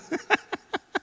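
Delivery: short high-pitched giggle; high laugh. Real, not acted. Preacher giggling over the big eater. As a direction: let the laugh slip out.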